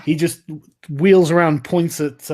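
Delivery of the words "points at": ordinary delivery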